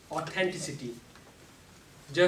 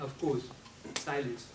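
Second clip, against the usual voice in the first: ordinary delivery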